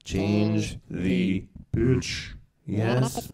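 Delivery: slowed, pitch-shifted effect voice. Shown as a demonstration. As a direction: run it through a pitch shifter. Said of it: "You can change".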